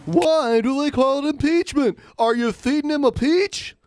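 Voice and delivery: high, stand-up observational voice; Seinfeld impression. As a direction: do a Jerry Seinfeld bit. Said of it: "Is this Seinfeld?".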